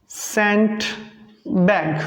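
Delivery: cent said short; English example word. Shown as a demonstration. Teacher modelling सेंट for students, short e. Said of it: "है ना friends?".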